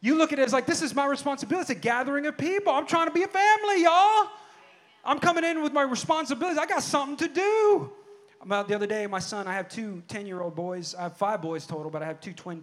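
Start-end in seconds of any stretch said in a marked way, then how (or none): 0.0-7.9 raised, higher-pitched preacher's voice; impassioned preaching. Real, not acted. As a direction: build into a fervent cry to the church. Preaches at full force.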